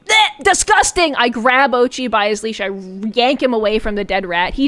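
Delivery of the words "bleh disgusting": high, loud grossed-out bleh; disgusted mock scream. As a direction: spit out disgust at the rat. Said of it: "I scream".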